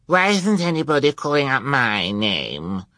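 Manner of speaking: put-on high, loud spoiled-girl whine; bratty demand. A narrator's reading. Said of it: "demanded Carmelita".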